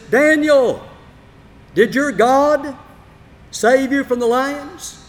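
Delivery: loud, high, slow call down the pit. Acted. King calls down into the lions' pit: loud, high, slow shout. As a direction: call out to Daniel below, drawn out and anxious.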